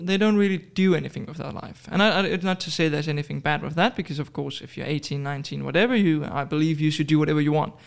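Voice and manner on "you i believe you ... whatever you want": ordinary talk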